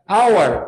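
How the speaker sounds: "Our" starting straight on the vowel; silent H. Shown as a demonstration. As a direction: model "Our" with no H sound at all. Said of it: "olha a diferença de pronúncia". ("Our" sem som de H no início)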